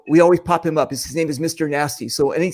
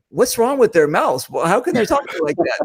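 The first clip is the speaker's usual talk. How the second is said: higher-pitched, exaggerated bewilderment; baffled kid; 5 semitones higher than usual. Host recalling kids hearing the accent, mock bewilderment. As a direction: act out a puzzled child's disbelief.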